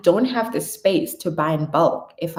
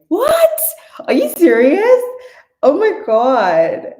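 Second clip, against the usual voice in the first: squeals up high, loud and amazed; over-the-top squeal of surprise; mock shock; 9 semitones higher, 8 dB louder, slower than usual. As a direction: gasp in pretend disbelief at the comment.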